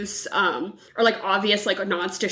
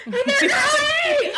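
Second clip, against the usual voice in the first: much higher, louder and slow, laughing through it; hammed-up sleazy greeting; 4 dB louder, slower than usual. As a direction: shout it like a creepy flirt, cracking up. Repeats quote, hamming it up.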